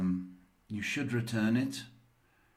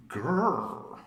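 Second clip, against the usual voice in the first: a mock animal growl, like a big cat; a short hammed-up grrr; playful growl; 3 semitones higher than usual. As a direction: growl it out to let the animal loose. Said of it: "let the animal come out".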